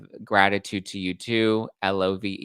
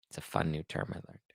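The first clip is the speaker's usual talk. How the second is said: ordinary delivery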